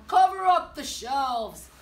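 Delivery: loud call to the elves. A narrator's reading. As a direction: boom the order across the workshop. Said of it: "He called to his elves".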